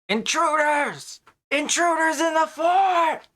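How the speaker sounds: high, loud, drawn-out goblin shriek; goblin yelling alarm. Acted. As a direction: raise the alarm at full pitch, stretching the words. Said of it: "you hear him yell out".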